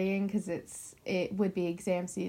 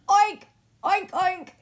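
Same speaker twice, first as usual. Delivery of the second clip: high, loud pig squeal of an oink; sudden high-pitched piggy oinks; 12 semitones higher, 10 dB louder, slower than usual. Shown as a demonstration. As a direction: oink like a pig as a game buzzer. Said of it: "I'll do a pig sound".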